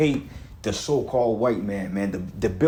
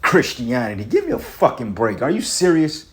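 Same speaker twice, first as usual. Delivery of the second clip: scornful disbelief; 3 semitones higher, 4 dB louder than usual. Real, not acted. Preacher dismissing Christianity to his audience, scornful disbelief. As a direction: throw it out in outraged disbelief.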